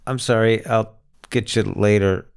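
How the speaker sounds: normal talking voice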